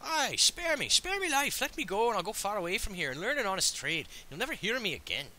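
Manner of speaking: high-pitched pleading in bad Irish; squeaky Irish robber pleading for his life; bad Irish accent. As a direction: beg for mercy in a hammed-up Irish accent. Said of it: "I don't know why he suddenly became Irish".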